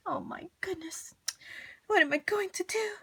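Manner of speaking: high, breathless despair; worried whisper. Acted. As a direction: fret aloud in panic. Mrs Prim despairs.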